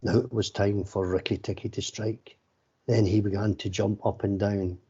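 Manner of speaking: ordinary delivery, nothing marked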